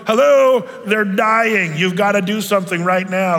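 mock exasperation. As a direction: let the frustration burst out at slow paramedics. Exasperated at paramedics' questions.

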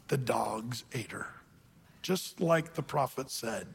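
low, slow, hushed storytelling; hushed reveal. Real, not acted. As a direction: drop almost to a whisper for the grim payoff. Reveals the grim punchline.

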